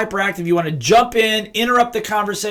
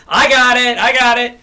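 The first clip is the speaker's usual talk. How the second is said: higher and louder, brushing off with I got it; dismissive I got it; 5 semitones higher, 5 dB louder than usual. Shown as a demonstration. Wrong way to answer an upset person, dismissive. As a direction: rattle off I got it to dismiss them. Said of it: "Don't just say".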